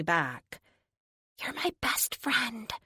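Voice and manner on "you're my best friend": breathy whispered secret into a friend's ear. Acted. Little girl whispering to her hero she's her best friend. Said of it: "she whispers in my ear".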